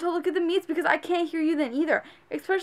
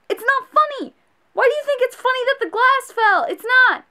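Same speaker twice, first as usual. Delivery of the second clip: annoyed; 10 semitones higher, 4 dB louder than usual. Real, not acted. Ranting at customers who laugh.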